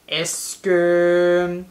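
low, drawn-out wondering; playful pondering. Pretend. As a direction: stretch it out while pretending to think. Playacts puzzling over the question.

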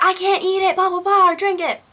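high-pitched mock whine; whiny protest. Retelling her protest to mom.

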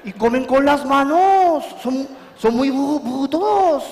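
a touch of a panicky French officer; frightened French general. Acted. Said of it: "Decía el general".

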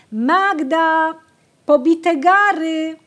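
high, loud and slow impatient call; impatient shout. A narrator's reading. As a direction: yell it across the field, fed up. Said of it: "krzyczeli zniecierpliwieni".

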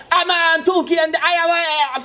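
lightly hammed-up fan gushing; mock swooning. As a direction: gush like a smitten fan. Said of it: "falan tarzı hislerle".

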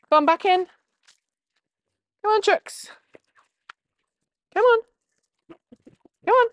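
high coaxing chicken call. Real, not acted. Herding hens to bed.

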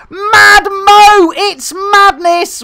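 excited shout. Real, not acted. Excitedly greeting a viewer.

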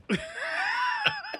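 real laughter breaking into a high cry. Real, not acted. Player left to drown by friends, crying laugh. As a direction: shriek with laughter until it nearly sobs.

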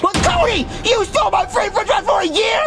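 high, shouting betrayal at his friend; accusing a friend of stealing his prize, angry shout; outraged shout. Acted. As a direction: yell it like he's been robbed. Friend stole his free fries.

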